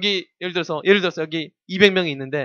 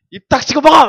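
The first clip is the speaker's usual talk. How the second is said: mock shout, higher and louder; hammed-up order to eat; forceful shout; 8 semitones higher, 11 dB louder than usual. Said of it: "막 그래서 이렇게".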